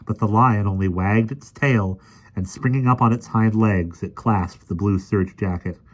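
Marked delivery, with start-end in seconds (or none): none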